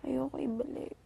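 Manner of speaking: breathy whisper, barely there. Real, not acted. Whispering to a friend that she won't return it. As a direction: tell a friend secretly that you won't give it back.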